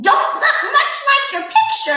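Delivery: high teasing jab; mocking witch. A narrator's reading. Witch teasing Old MacDonald, high and cheeky. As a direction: needle him about his picture.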